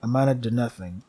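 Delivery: steady storyteller reading of the game text; game story narration. Acted. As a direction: narrate it like a dark fable.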